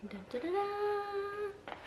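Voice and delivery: high, drawn-out "dun dun dun" reveal; high, soft drumroll tease; sung mock fanfare. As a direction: softly sing a little fanfare, holding the last note. Drumroll before revealing the gift.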